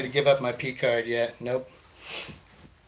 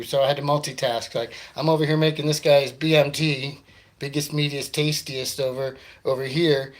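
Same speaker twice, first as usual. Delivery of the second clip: normal talking voice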